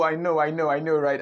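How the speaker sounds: ordinary talk, nothing marked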